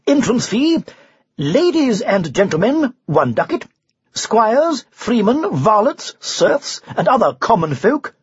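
high, ringing announcement; town crier proclamation. Acted. Knight reads a tournament notice to his companions, in a crier's proclamation. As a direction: proclaim the notice grandly, drawing out key phrases.

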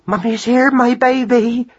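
high-pitched doting mother; crying out. A narrator's reading. Mother answering her waking son, high doting cry. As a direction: cry it high, cooing to baby. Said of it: "cried Madeline".

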